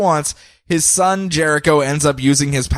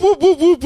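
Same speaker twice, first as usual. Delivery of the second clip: squeaky whoop noises; 4 dB louder than usual. Retold or imitated. Imitating villains fleeing.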